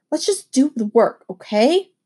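usual speaking voice

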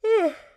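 big open yawn-sigh; loud yawning sigh. Real, not acted. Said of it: "that's why I'm still yawning right now".

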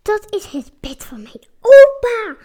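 loud, eager cry about grandpa's bed; excited shout. A narrator's reading. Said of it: "roept Pier opgewonden". (opgewonden roepen, veel luider)

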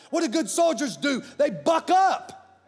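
high, loud, slow proclamation; preaching climax. Real, not acted. Preacher rallying the congregation, loud and slow. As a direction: hammer it home to the crowd.

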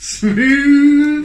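high, loud teasing "smooth"; drawn out, hammy and playful; high mock smooth.

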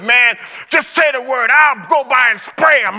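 hammed-up buddy ready to ride; friend's offer. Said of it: "one of my good friends ... said".

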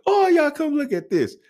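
very high-pitched holler to everyone; mock calling out. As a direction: replay the cashier summoning all her coworkers. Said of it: "she calls her out".

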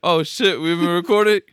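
loud laughing surprise; laughing loudly. Real, not acted. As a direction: caught off guard, laughing hard. Caught recording, loud laughing.

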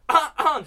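loud phlegmy throat clearing; chesty cough. Retold or imitated. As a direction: hack up phlegm loudly. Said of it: "こんな感じやったら痰が絡んじゃってんのよ". (痰が絡んだ咳払い)

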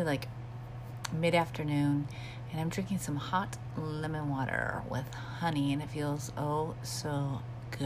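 normal talking voice

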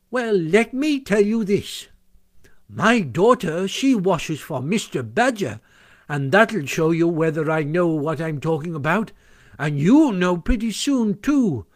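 high, slow, emphatic warning; Mole as washerwoman. A narrator's reading. Washerwoman warns the sentries of attack, portentous. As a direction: spin out the threat with relish.

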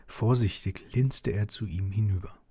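ordinary delivery, nothing marked

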